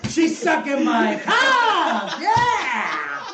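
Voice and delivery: slow, high, hammed-up holler; over-the-top movie villain bellow; Al Pacino impression. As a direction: stretch each word into a gleeful mock roar. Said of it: "Pacino's sitting at the head".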